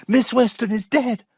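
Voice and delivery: high alarmed cry. A narrator's reading. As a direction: cry out that she's dead. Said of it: "two or three voices cried out".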